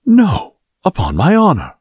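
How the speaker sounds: high, emphatic protest; crying out. A narrator's reading. Guardsman protests his honor to Aramis, crying out high. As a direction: cry it out with pitch leaping up. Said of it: "cried his Majesty's guardsman".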